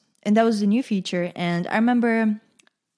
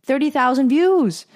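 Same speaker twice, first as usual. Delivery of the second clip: loud, high, drawn-out excitement; thrilled, slow and emphatic; amazed exclamation; 5 semitones higher, 7 dB louder, slower than usual. Real, not acted. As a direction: stretch it out in loud disbelief. Reliving amazement at view count.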